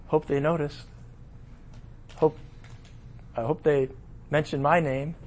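low, soft wishful murmur; mock vain hope; hopeful murmur. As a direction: quietly wish to be named. Remembering awards banquet hopes.

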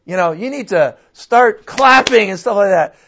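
loud, high pep-rally push; fired-up preacher. Retold or imitated. Mark urging the Delhi church to clap, loud and fired up. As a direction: whip the crowd into clapping. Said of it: "And he says".

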